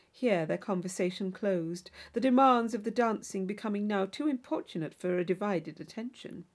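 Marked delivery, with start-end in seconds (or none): none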